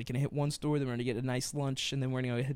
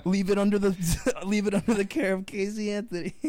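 much higher, louder hammed-up host; game show host; 7 semitones higher, 5 dB louder than usual. Pretending to be the host offering grim options, loud and high. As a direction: pitch it like an over-the-top TV host.

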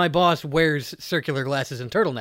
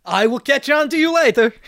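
mock Eastern European; 8 semitones higher, 4 dB louder than usual.

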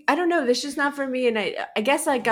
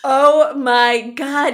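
loud, higher, drawn-out shock; shocked disbelief; 6 semitones higher, 8 dB louder, much slower than usual. Real, not acted. Listener reacting to the lottery story's twist, shocked. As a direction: stretch it out in stunned disbelief.

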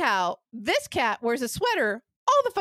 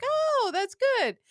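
fake enthusiasm; 12 semitones higher, slower than usual. Sarcastic reply to niece.

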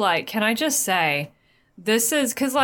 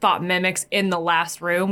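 ordinary delivery

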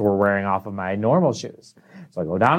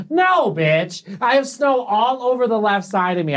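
sarcastic; 9 semitones higher than usual. Retold or imitated. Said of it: "I walk in".

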